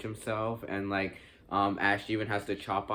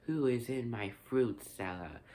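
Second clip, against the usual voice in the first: imitated creepy Henrita; 3 dB quieter than usual. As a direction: imitate a creepy creature, stretching out the question. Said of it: "was pretty creepy when Henrita showed up".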